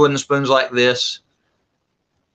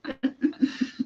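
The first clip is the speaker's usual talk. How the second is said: breathy laugh; 12 semitones higher, 8 dB quieter than usual. Real, not acted. Laughing at the joke.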